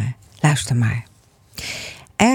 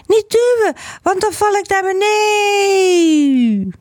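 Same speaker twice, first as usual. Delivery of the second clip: very high, stretched-out eaglet wail; panicked cry. A narrator's reading. Little eagle pushed off the edge, panicked wail. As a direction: squeal it, drawing the end into a long falling cry. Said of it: "riep hij".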